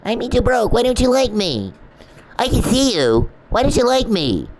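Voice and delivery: high, loud mock whine of a needy man; an exaggerated high, loud imitation of a self-pitying stalker; mocking the stalker again. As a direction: drag out the pouting creep's whine.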